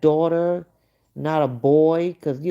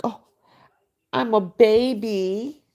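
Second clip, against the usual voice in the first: mock big baby; 6 semitones higher than usual. Mocking a woman who wants to be a child, babyish. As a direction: lightly whine it like a grown baby. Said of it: "She acts like she wants to be a baby".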